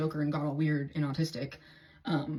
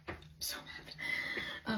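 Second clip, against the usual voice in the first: a slow defeated whisper; 8 dB quieter, much slower than usual. Real, not acted.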